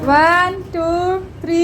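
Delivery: calling out the count loudly. Real, not acted. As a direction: count it out loud and high for everyone. Counting aloud before a throw. (ज़ोर से गिनती)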